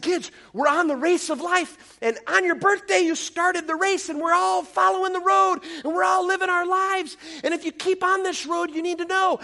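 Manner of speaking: rally the little ones with big energy; preaching to kids. Acted. Pastor preaching to kindergartners.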